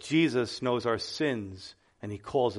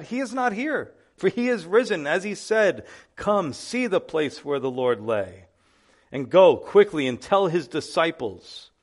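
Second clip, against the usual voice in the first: scripture reading; 3 semitones higher, 4 dB louder than usual. Acted. Pastor reading Matthew to the congregation, proclaimed scripture. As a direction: proclaim the verses to the church.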